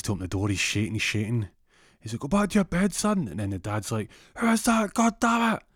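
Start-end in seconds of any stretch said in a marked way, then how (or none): 2.2-3.3 very high, strained fake father; hammed-up impersonation, pitched far up; put-on dad voice. Burglar repeating his act as the dad, very high put-on voice. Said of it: "Jeff puts on a voice". 4.4-5.6 very high, loud mock shout; angry dad. Father confronting the intruder, high angry yell.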